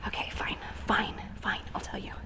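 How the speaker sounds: soft resigned whisper. Real, not acted. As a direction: give in under your breath. Playful giving in to viewers.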